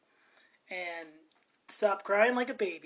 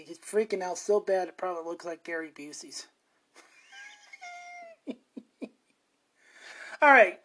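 ordinary talk, nothing marked

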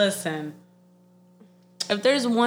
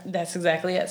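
normal talking voice

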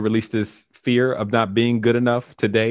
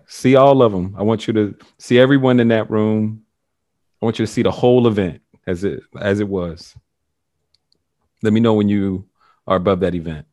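guided meditation; 4 semitones lower than usual. Acted. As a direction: lead them gently down the timeline. Guides the session with long pauses.

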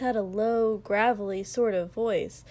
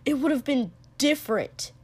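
higher, louder sharp retort; 4 semitones higher, 5 dB louder than usual. A narrator's reading. Katsuki about the one who left, sharp and louder. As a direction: cut in hard. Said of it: "Katsuki said sharply".

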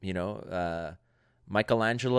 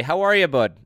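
light, punchy Canadian greeting; Canadian accent; 5 semitones higher, 5 dB louder than usual. Shown as a demonstration. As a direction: demonstrate a friendly Canadian accent, a bit louder. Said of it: "can you do a Canadian accent".

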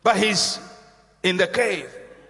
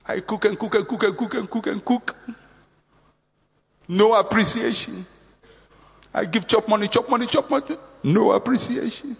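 unappreciated complaining; 3 semitones higher than usual. Acted. Unappreciated person in a cave listing chores, complaining. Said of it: "When you start talking like that".

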